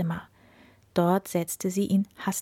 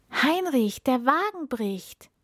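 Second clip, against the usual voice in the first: high-pitched cry of warning; alarmed call; 7 semitones higher than usual. A narrator's reading. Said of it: "rief der Prinz". (erschrockener, hoher Ausruf des Prinzen)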